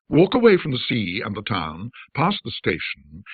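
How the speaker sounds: ordinary delivery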